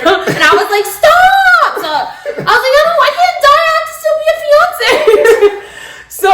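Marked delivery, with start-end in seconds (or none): 0.9-1.8 high, laughing stop; laughing protest. Retold or imitated. Girlfriend protesting the strap joke, laughing. 2.8-5.6 high, laughing playful panic; mock panic laughing. Girlfriend joking about dying before engagement, laughing.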